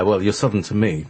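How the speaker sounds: usual speaking voice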